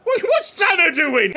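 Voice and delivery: ordinary talk, nothing marked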